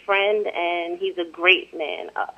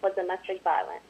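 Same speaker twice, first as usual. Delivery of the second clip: soft, dropping read-aloud; quietly read, barely projected; too quiet; 7 dB quieter than usual. Real, not acted. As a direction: let the volume fall away as you read. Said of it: "speak up a little louder".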